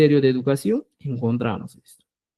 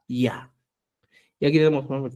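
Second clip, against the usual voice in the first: usual speaking voice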